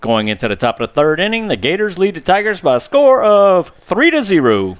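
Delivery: booming stadium announcer read; game announcer over the stadium speakers. Acted. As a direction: call it like the PA announcer. Announcer call.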